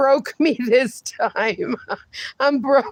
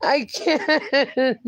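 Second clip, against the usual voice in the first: usual speaking voice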